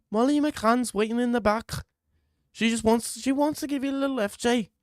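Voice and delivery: hammed-up high Liverpool accent on request; mock Liverpool accent. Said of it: "Mimic Patty's accent again".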